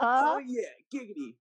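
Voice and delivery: high sleazy cartoon giggity; Quagmire impression. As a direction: do Quagmire's creepy catchphrase. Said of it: "Quagmire was my role model".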